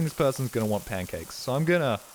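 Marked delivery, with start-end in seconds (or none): none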